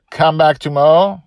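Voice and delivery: ordinary delivery, nothing marked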